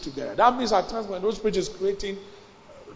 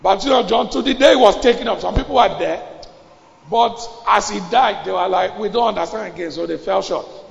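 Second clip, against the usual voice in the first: fiery preaching; 3 semitones higher, 7 dB louder than usual. Acted. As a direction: drive the congregation with force. Preaching at full force.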